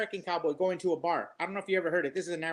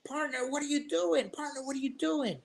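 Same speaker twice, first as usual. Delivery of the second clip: hammed-up cowboy, higher, asked twice; mock American cowboy, puzzled; 7 semitones higher than usual. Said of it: "the American cowboy goes".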